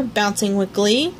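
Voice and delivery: plain reading voice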